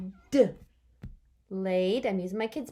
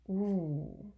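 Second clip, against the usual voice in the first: normal talking voice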